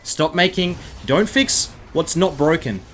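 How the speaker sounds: usual speaking voice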